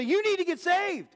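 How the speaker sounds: high-pitched mock urgency; pushy evangelizing. Zealous believer confronting a stranger, pushy mock urgency. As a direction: press the warning hard, as a caricature.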